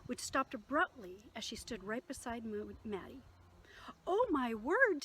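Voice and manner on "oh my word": higher and louder, caught off guard; surprised exclamation. Acted. Tilly spots the pastor in the sky, loud and surprised. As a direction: gasp it out as the parachute appears. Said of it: "Tilly said".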